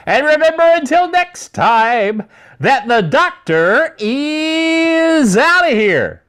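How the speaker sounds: high, slow, drawn-out catchphrase; announcer sign-off. Acted. Host signing off as the doctor, drawn-out announcer flourish. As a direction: stretch it out like a showman's big farewell.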